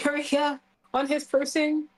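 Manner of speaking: ordinary talk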